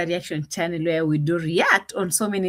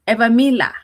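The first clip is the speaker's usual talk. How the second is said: ordinary talk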